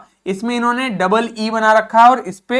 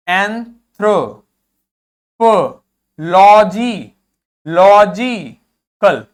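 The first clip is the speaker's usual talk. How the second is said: Anthropology very slow and loud, in pieces; loud, drawn-out breakdown of anthropology; syllable by syllable; 6 dB louder, much slower than usual. Shown as a demonstration. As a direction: break it up, leaning on लॉ in logical. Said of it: "जो भी capital में लिखा है".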